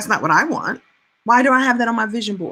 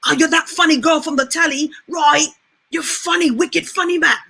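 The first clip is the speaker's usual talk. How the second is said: put-on thick London British accent, pitched up; Idris Elba; 5 semitones higher than usual. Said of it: "that's what he said to me".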